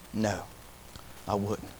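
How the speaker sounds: low, soft retold refusal; subdued, low-pitched no; quiet refusal. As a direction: turn it down quietly, voice dropped. Man refuses the invitation, retold.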